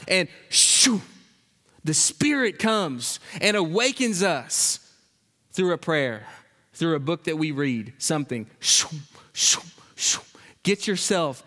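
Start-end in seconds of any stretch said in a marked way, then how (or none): 0.5-1.0 sudden breathy "shoo" whoosh; whooshing sound. Shown as a demonstration. Preacher imitates the Spirit coming to awaken us, whooshing. 8.6-10.2 three whispered whooshes; whooshing sounds. Shown as a demonstration. Preacher imitates the Spirit awakening us through prayer, whispered whooshes.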